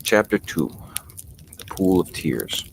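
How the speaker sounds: ordinary delivery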